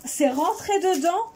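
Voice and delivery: amused disbelief, chuckling; real laugh while talking. Real, not acted. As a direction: laugh through it, slow and high. Glitter got inside, she laughs.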